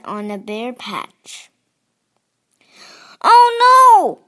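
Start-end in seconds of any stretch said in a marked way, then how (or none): 3.2-4.2 high, loud wail of dismay. A narrator's reading. Franklin on the bare patch, wailing. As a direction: wail it like a disappointed child. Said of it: "wailed Franklin".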